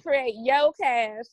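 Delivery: "your" said as "yo", slowly; Southern "yo". Shown as a demonstration. Guest showing her school's Southern name, "your" pronounced "yo". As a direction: drop the r so "your" becomes "yo". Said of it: "is intentionally yo cash".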